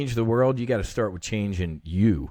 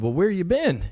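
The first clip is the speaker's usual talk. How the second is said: higher, louder casual "where you been"; casual greeting; 8 semitones higher, 4 dB louder than usual. Retold or imitated. Meeting God, higher casual buddy greeting. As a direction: greet him like an old buddy. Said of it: "I said".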